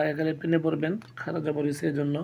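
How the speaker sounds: ordinary delivery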